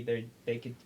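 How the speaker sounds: normal talking voice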